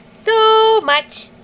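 put-on high baby-talk hello; cooing hello, drawn out; high sing-song greeting. Answering for baby.

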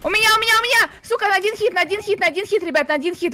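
panicked shouting. Real, not acted. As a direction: cry out in alarm. Ambushed from behind, panicking.

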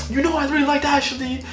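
high-pitched mock-tearful whine; crying friend. Dave confiding in narrator about Ashley, high mock-crying voice. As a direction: imitate a heartbroken teen lamenting. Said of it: "He's, like, crying".

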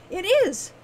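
high, loud protest; insistent. A narrator's reading. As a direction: insist against his doubt. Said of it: "Wren insisted".

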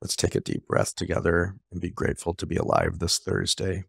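soft, calm prayer-like blessing; guided meditation. Acted. Host closing the show with a blessing for lawyers, soft and calm. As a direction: ease the listeners into quiet gratitude.

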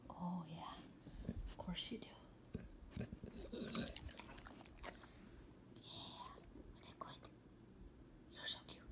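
breathy whisper to the pets; hushed cooing. Real, not acted. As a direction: whisper sweet nothings to the dog. Whispering to the dogs.

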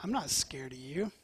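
lion unimpressed by the shepherd. Acted. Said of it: "the lion just looks at him and says".

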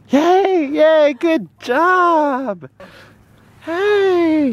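drawn-out, high yay; excited cheer. Real, not acted. Owner cheering her puppy, high and drawn out. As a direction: cheer slowly and sweetly.

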